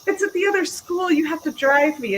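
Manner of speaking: imitating her pushy younger self; whiny kid pleading. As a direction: whine at mom like a desperate kid. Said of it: "And I'm like".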